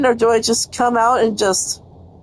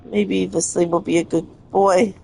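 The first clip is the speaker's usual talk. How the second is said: ordinary talk, nothing marked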